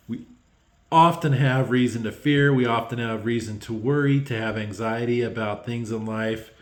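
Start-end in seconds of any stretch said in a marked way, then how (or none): none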